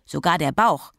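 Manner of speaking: put-on child proudly showing off; triumphant. A narrator's reading. Child showing Mama her spotty belly, triumphant. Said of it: "sagte sie triumphierend".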